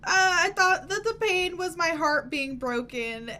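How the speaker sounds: high, theatrical heartbroken voice; mock melodrama; melodramatic. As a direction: ham up teen heartbreak. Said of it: "my fucking melodramatic ass thought".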